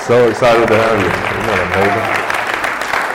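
excited welcome. Real, not acted. Host welcomes visiting guest.

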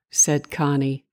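ordinary delivery, nothing marked

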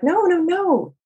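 high, insistent no no no; emphatic reassurance. Retold or imitated. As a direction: cut off their apology, eager to reassure. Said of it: "I'm like".

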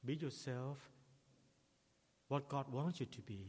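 ordinary talk

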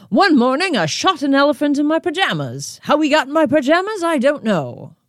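high-pitched hammed-up comic voice, quick through the joke; bad Groucho impression. Host quoting Animal Crackers line to listeners, bad hammed-up comic voice. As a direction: toss off the gag like an old vaudeville comedian, badly. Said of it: "that was a bad, bad impression".

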